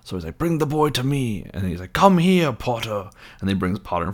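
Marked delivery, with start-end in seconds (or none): 0.4-1.5 imitated dark lord's order; villain's command. 1.9-3.1 high, loud, falling command; hammed-up villain beckoning; villain summoning. Quirrell summons Harry.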